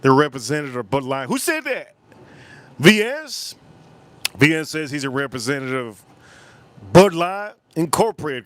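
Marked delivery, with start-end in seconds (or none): 1.3-3.6 surprised. Real, not acted. Reacts to Bud Light fan.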